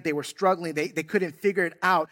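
ordinary delivery

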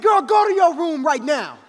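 loud, high-pitched parental outburst; angry order. Retold or imitated. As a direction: yell at the child to leave at once. Retold angry order to daughter.

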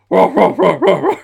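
laughing monster roar. Retold or imitated. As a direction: cackle it out like a gleeful pterodactyl. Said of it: "Rodan is laughing".

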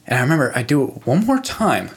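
ordinary talk

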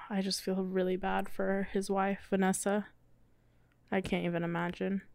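soft, heavy-hearted sigh; quietly saddened, letting it sink in; sad and subdued. Real, not acted. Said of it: "You're bumming me out".

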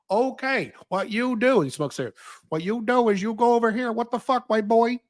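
imitating a Chinese man in a thick Chinese accent; mock Chinese accent. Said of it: "I can do a Chinese accent".

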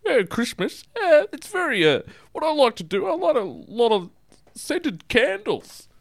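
falsetto caller, savouring words; high put-on voice.

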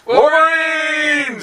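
long drawn-out high yell; battle cry shout. Pretend.

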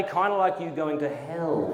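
usual speaking voice

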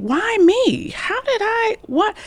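high, baffled disbelief; asking it to himself as a puzzled kid; incredulous. Retold or imitated. Boy picked to lead the pledge, high baffled disbelief. Retells his own disbelief as a child.

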